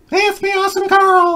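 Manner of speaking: very high, bright mascot voice; cartoon ad voice. Mock ad character voice.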